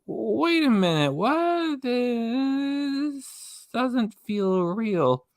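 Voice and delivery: high, slow dawning disbelief; mock disbelief. Mocking fans who never question her, slow high disbelief. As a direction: pretend it finally dawns on you, slowly. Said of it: "they never like".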